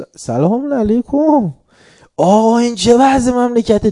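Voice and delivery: slow, high, loud shouted greeting and rebuke; shouting. Retold or imitated. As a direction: drag out the salaam, then yell at the shah. (فریاد بلند و کشیده)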